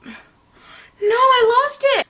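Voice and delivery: high, loud laugh-cry; losing it laughing; cracking up. Real, not acted. Cracking up at his reply.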